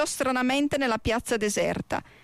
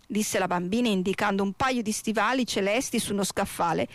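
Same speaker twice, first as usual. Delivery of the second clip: ordinary delivery, nothing marked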